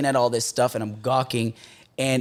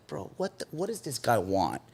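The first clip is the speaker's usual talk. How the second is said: much softer, hushed gossip; suspicious murmur; 3 semitones lower, 11 dB quieter than usual. Retold or imitated. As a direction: mutter it behind his back. Staff gossiping about him.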